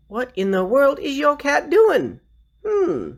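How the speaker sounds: high, slow baffled question; acted puzzlement. Storyteller asking the cat's owner about odd behavior, high and puzzled. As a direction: ask it high and drawn out, mock bewildered. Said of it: "I gave it a puzzled look".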